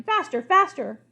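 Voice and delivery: high, loud chase cry; shouting. Acted. Crocodile shouts after the running king, high and loud.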